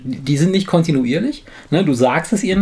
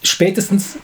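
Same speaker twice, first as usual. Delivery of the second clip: ordinary talk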